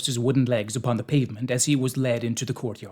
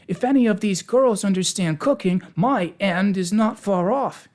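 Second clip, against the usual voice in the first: high, worried character voice; anxious; 8 semitones higher, 5 dB louder than usual. A narrator's reading. Pumpkinhead fearing the girls will cook him, anxious. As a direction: worry aloud, pitched well up. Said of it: "said the Pumpkinhead anxiously".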